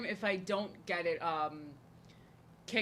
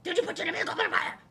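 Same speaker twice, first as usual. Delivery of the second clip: high, shrill-free but sharp yelled question; Dumbledore freaking out; 10 semitones higher, 5 dB louder than usual. Retold or imitated. Dumbledore freaking out at Harry, yelling high. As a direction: blast the question at Harry in a panic. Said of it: "He just freaks the fuck out on Harry".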